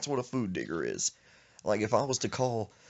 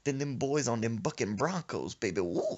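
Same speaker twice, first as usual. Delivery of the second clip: usual speaking voice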